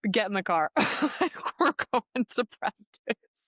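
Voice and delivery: dad's order quoted through laughter; laughing retelling. Retold or imitated. Athlete retelling dad's reply to her speech, laughing.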